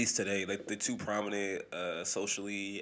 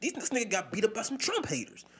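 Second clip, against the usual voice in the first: high, louder scolding voice; mock accusing friend; 12 semitones higher, 3 dB louder than usual. Imitating people calling him a traitor, high mock scolding. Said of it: "I'm instantly looked at as like".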